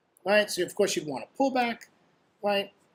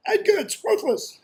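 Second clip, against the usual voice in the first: squeaky, much higher cartoon voice; mock high voice; 13 semitones higher, slower than usual. Trader mocking his crappy trend line, high and silly. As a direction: jump way up to mock it.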